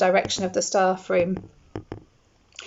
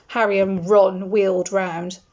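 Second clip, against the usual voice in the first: ordinary delivery, nothing marked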